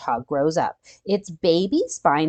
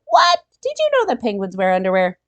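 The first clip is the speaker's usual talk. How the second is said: amused surprise; 4 dB louder, faster than usual. Real, not acted. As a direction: act shocked and tickled by the idea.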